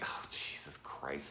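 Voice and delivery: whispered and slow; whispered Jesus Christ. Retold or imitated. Dreading another cocaine comment, slow whisper. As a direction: whisper it, exasperated. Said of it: "I'm like".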